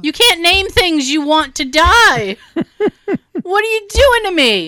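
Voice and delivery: comic outrage climbing to a high wail; acted-out indignant objection; exasperated protest. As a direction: plead in mock exasperation. Acted quarrel over named snakes.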